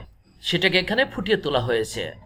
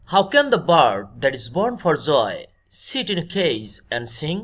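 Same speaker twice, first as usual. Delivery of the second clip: slow, measured reading of verse; deliberate classroom poem reading; poem recitation; 3 semitones lower, much slower than usual. Acted. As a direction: read the line out slowly, phrase by phrase. Reads the poem line aloud.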